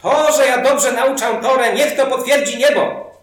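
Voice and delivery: slow, loud, high proclamation to heaven; loud calling out. Retold or imitated. Rabbi appeals to heaven to confirm his teaching, calling loudly. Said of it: "zawołał głośno, głośno, mówi tak".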